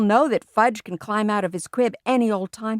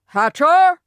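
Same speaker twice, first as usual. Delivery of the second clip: loud, high boom of a name; 9 semitones higher, 9 dB louder than usual. A narrator's reading. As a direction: bellow it like an outraged guest. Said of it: "Mister Yarby boomed".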